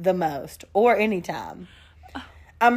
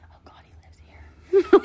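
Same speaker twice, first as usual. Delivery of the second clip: hushed aside whisper; breathy whisper under the breath; 11 semitones higher, 4 dB louder, much faster than usual. Pretend. Unspoken thought said aside.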